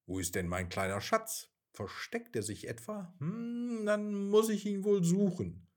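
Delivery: sing-song mock-searching mother, rising high; playful mother searching. A narrator's reading. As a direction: pretend you can't find the hiding child. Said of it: "hörte er seine Mama laut sagen".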